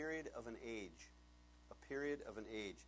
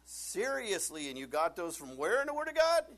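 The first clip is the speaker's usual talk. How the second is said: incredulous challenge; 5 semitones higher, 9 dB louder than usual. Acted. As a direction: challenge them in loud, mounting disbelief. Preacher challenges imagined couple, incredulous.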